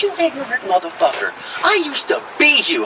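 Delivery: high-pitched, indignant outburst; high mock outrage without shouting; losing his cool. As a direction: act out losing your temper, voice climbing. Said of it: "That's when I lost my cool".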